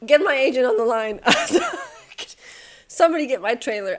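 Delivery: loud, hammed-up star demanding; mock diva. Exhausted solver hamming up a diva demanding her trailer. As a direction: play the fed-up celebrity.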